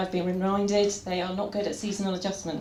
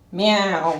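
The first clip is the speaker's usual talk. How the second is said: louder, laughing mock meow; attention meow; 4 dB louder than usual. Shown as a demonstration. Owner imitating her cat wanting attention, laughing meow. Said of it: "It's like this".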